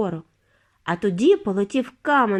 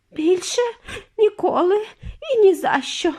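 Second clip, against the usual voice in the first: moaning; 10 semitones higher, 3 dB louder than usual. A narrator's reading. Mrs TV moaning after the ride, high and loud. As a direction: moan each word out. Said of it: "простогнала пані Тіві".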